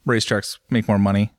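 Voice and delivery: normal talking voice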